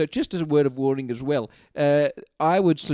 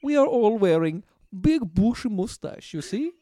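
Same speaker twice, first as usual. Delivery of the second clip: put-on raised-pitch accented Soviet dictator, laying down fashion rules; Joseph Stalin; 11 semitones higher, 4 dB louder than usual. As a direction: play an insistent accented dictator prescribing fashion. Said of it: "Joseph Stalin here".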